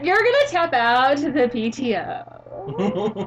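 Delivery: put-on slow, stretched sorority-girl drawl. Sorority girl taunting her wrestling opponent, mock drawl. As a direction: drag the words out like a sorority girl. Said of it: "That is the word sorority".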